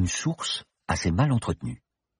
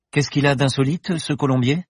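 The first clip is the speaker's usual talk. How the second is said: put-on curious young woman; 8 semitones higher, 3 dB louder than usual. Paloma asks the regular.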